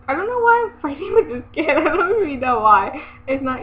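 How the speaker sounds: frustrated laugh. Real, not acted.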